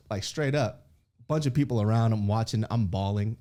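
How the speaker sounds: ordinary talk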